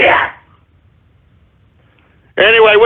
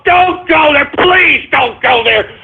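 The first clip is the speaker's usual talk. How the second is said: higher, exasperated plea; pleading shout; 8 semitones higher than usual. Real, not acted. Host begging listeners to drop the subject, exasperated high plea. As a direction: beg them in a raised, high voice.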